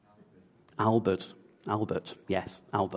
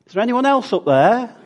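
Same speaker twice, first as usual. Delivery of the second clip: high, loud comic call upward; 12 semitones higher, 10 dB louder than usual. Acted. Man told to let go of the branch, calling out for other help. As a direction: shout hopefully for someone else.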